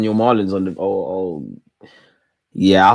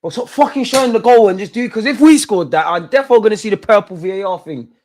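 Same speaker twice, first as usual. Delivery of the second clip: frustrated shouting; 7 semitones higher, 6 dB louder than usual. Real, not acted. Frustrated at replay.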